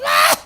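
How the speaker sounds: sudden loud cry imitating the girl; loud mock wail. Preacher imitating a new convert's cry, loud mock wail. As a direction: let out a mock sobbing ah.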